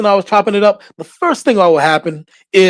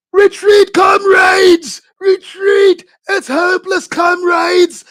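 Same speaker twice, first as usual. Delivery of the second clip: high, loud hammed-up British-accent shout of retreating troops; panicked British-accented soldier; 14 semitones higher, 4 dB louder, slower than usual. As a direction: yell in a movie British accent, fleeing the front. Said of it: "Russians had British accents".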